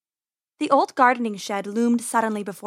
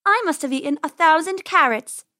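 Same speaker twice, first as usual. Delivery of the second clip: high, loud bragging little rabbit; louder, higher sibling one-upmanship; childish boast; 6 semitones higher, 6 dB louder than usual. A narrator's reading. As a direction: outdo your brother, pitched up.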